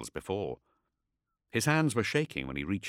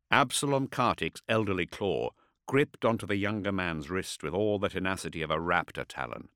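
ordinary delivery, nothing marked

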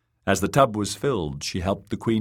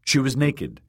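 ordinary delivery

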